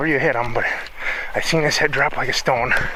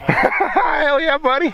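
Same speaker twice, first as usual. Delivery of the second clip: excited cheer; 14 semitones higher, much slower than usual. Real, not acted. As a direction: celebrate the hit. Celebrating a successful shot.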